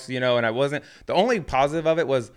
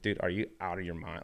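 much softer, low disbelieving hiss; quietly asking if he's lost his mind; hushed aside; 5 semitones lower, 12 dB quieter than usual. Retold or imitated. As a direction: murmur it so no one hears.

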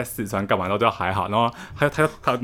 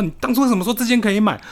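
higher mock-angry demand, retold; imitating angry roommate; 11 semitones higher, 3 dB louder than usual. Roommate blaming her for the shirt, mock-angry higher question. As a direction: replay the roommate's annoyed complaint, higher. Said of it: "他就很生气".

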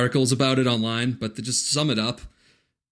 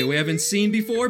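ordinary delivery

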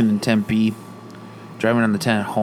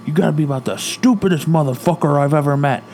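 retold angry dad; 5 semitones higher, 3 dB louder than usual. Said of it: "he starts screaming at the guy".

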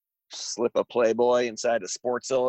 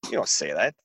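ordinary delivery

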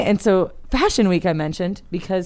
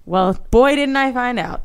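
normal talking voice

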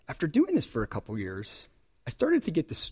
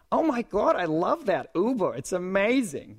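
put-on higher, gushing old lady voice; mother-in-law voice; 7 semitones higher than usual. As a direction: gush about it like a thrilled mother-in-law. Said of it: "that's my mother-in-law voice".

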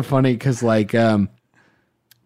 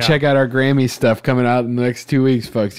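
ordinary talk, nothing marked

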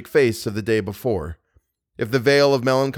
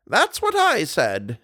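put-on old woman voice; 5 semitones higher, slower than usual. A narrator's reading. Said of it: "exclaimed the old lady".

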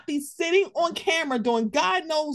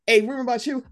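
ordinary talk

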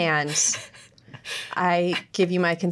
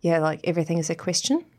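Kiwi accent, rising at line ends; New Zealand uptalk. Shown as a demonstration. Host showing the accent's questioning tune, in New Zealand uptalk. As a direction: end each phrase like a question, New Zealand style. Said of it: "you inflect everything at the end".

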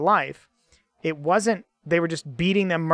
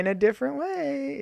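high mock cheerful; 8 semitones higher, 4 dB quieter than usual. Mocking Joseph's cheerful delivery.

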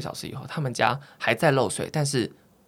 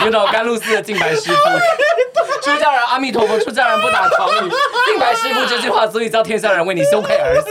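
Jingbai from Ganlu Temple; 11 semitones higher, 7 dB louder than usual. Pretend. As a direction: recite like a costume drama heroine.

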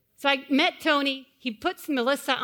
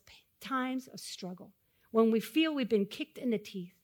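softer, slow, intimate sermon; tender preaching; 4 dB quieter, slower than usual. Acted. Pastor comforting the struggling congregation softly. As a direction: draw them close gently.

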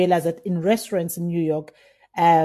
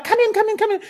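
eager high call, much higher than usual; excited calling; 14 semitones higher, faster than usual. Retold or imitated. Calling the cellar master to the wine bins, eager and high. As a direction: beckon him over, bursting with excitement.

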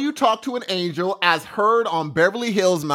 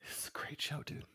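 a soft, breathy whispered aside; 2 semitones lower, 23 dB quieter than usual. Real, not acted. Co-host whispers agreement about Dexter to his friend, hushed whisper. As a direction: lean in and whisper it.

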